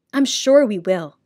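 ordinary delivery

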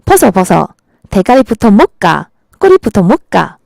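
imitated punchy Gyeongsang intonation; Gyeongsang woman's accent. Shown as a demonstration. Gyeongsang woman asks about fish-bread, imitated Gyeongsang accent. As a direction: put on a blunt Gyeongsang dialect. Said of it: "경상도 여자들은".

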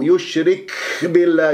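يشرك with too much air after the k; exaggerated kaf breath. Shown as a demonstration. Teacher mimicking a common error, over-breathed kaf in يشرك. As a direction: overdo the puff of breath ending يشرك. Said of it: "يبالغ في الهمس".

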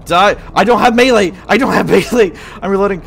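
high, loud panicked yelling; frantic shouting. Real, not acted. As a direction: yell in the middle of the fight. Close fight, no melee weapon.

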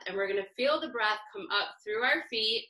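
ordinary talk, nothing marked

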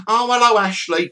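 high, bright-ish woman's greeting; lightly voiced woman on the phone; Carol's voice.

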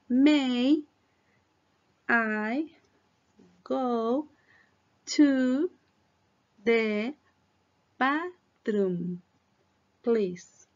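each word set apart with long pauses; slow word by word. Shown as a demonstration. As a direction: say it slowly, one word at a time. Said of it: "Voy más despacito".

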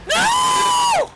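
very high, loud screamed no; anguished scream. Real, not acted. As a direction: scream at the loss. Death in game, must redo.

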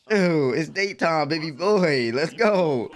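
normal talking voice

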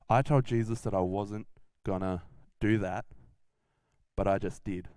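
low, halting regret; regretful realisation. Acted. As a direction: let the guilt sink in, low and halting. Peter realises his denial after rooster.

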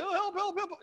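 high, whiny mock cry for help; mock whining plea. Disciples mocking the woman, high whiny plea. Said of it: "She keeps on whining".

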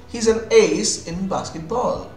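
usual speaking voice

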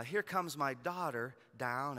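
usual speaking voice

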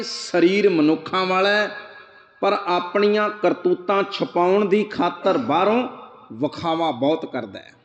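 ordinary talk

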